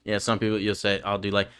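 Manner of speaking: normal talking voice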